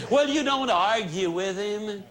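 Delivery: usual speaking voice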